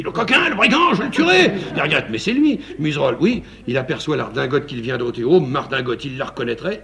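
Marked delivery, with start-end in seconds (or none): 0.0-1.5 much higher, loud furious threat; raging marquis. Acted. Marquis heard offstage, furious at the intruder. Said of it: "on entend la voix du marquis". 2.1-2.6 alarmed. Acted. Frightened cry at marquis's voice.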